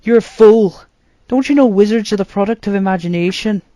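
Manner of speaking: high, loud shocked cry; shocked outburst. A narrator's reading. Arthur, shocked by his brother's quest, bursting out. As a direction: blurt it out in disbelief. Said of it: "entirely shocked at his reason".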